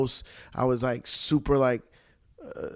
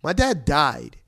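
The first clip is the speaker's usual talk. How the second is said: heated retort; 4 semitones higher, 5 dB louder than usual. Retold or imitated. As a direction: push back harder and harder, voice climbing. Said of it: "I'm like".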